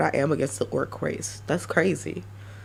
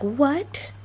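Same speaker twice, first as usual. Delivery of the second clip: high, rising double take; hammed-up confused what, pitched much higher; mock disbelief; 8 semitones higher than usual. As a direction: play a listener caught off guard. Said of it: "you'll be like".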